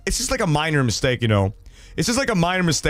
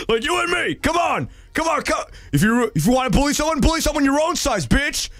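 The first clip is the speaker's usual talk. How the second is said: tough challenge; 10 semitones higher than usual. Acted. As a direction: call the bully out, high and cocky. Acted second confrontation with bully.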